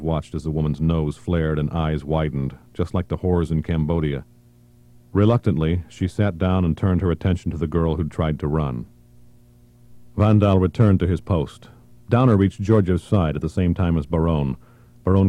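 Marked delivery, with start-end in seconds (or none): none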